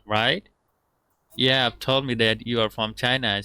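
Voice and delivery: ordinary talk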